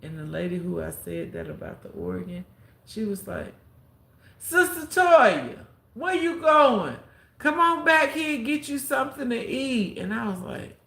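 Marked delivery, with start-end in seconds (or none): 4.4-10.0 imitating a public call-out. Church lady calls Toya back to eat, hollered imitation. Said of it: "calling names out on the intercom".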